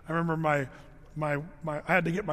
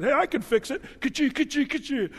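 higher, louder teasing dad with nail gun noises; joking dad; 9 semitones higher, 3 dB louder than usual. Retold or imitated. Dad teasing Debbie about her broken nail, playful mock offer. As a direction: ham up the prankster dad. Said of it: "And my dad said".

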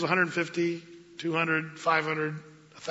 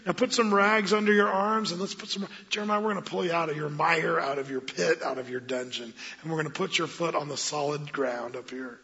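soft, much quieter and tender; 4 dB quieter than usual. Retold or imitated. Ebed-melech gently reassuring Jeremiah in the pit, tender. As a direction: coax him out gently like a caring friend. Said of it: "do you sense the tenderness here".

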